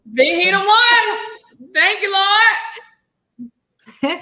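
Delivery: high, loud joyful exclamation; excited shout. Real, not acted. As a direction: shout praise to the heavens. Cheering the commenter's man.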